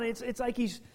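ordinary talk

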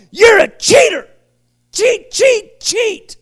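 high, loud jeering at a cheat; mock-accusing chant of cheat; accusing shout. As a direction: point the finger and shout him down. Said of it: "look at Matthew and go".

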